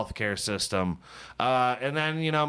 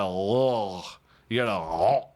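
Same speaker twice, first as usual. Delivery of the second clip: normal talking voice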